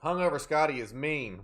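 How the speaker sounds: ordinary delivery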